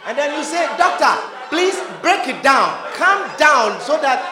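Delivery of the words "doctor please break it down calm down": shouted, half-laughing begging; loud mock plea. Patient shouts at the doctor to explain simply, loud and comic. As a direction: overplay the scared patient.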